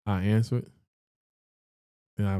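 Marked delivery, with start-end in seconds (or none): none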